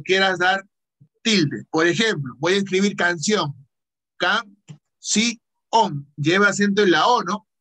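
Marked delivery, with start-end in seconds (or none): 4.2-6.1 "Can-si-on" broken into three separate syllables. Shown as a demonstration. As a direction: sound it out piece by piece while typing. Spells the word while typing it.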